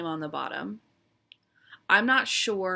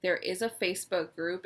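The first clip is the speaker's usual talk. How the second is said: usual speaking voice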